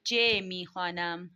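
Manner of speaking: slow, measured Persian lesson heading; slow announcing read. Shown as a demonstration. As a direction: announce the new letter slowly like a textbook. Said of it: "اس کے بعد ہم آگے بڑھ جاتے ہیں".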